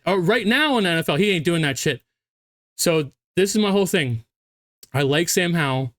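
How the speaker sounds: ordinary talk, nothing marked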